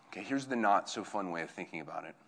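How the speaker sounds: usual speaking voice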